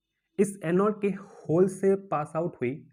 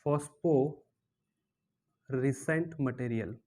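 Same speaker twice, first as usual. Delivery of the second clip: drawn-out key term; 4 semitones lower, 5 dB quieter, much slower than usual. Shown as a demonstration. Teacher slowly drawls the new term phosphorescent for the class. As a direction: stretch the word out very slowly and lower.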